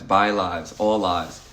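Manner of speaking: ordinary talk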